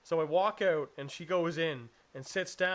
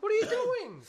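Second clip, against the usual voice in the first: loud, very high mock shriek; horrified, pitch shooting up; freaking out; 5 dB louder than usual. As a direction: imitate an appalled partner. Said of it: "She's freaking out".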